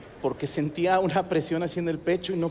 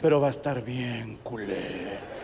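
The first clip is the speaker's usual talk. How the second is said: low, slow, drawn-out foreboding; mock-sinister inner voice; ominous warning; 7 semitones lower, 3 dB quieter, slower than usual. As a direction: stretch it out like a looming threat. Brain forebodingly warning its owner.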